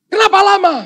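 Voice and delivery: high, loud outburst of impatience; impatient yelling. Acted. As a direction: scold the latecomer at full voice. Stranded man scolds late angel.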